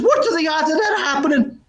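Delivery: high, amazed Irishman; Irish brogue. Acted. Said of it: "a bit of a brogue".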